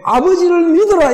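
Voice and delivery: fervent exhortation. Acted. Preacher urging the congregation to trust God, fervent cry. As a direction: lift the command to the congregation.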